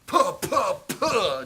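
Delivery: loud, high puffs of impact; punched-face sputtering. Acted. Game master voices Stucky getting punched, loud sputtering. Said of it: "Stucky's like".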